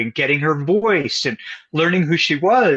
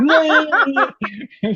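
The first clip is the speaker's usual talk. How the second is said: laugh; 13 semitones higher than usual. Real, not acted. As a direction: burst of high laughter. Laughs at the memory.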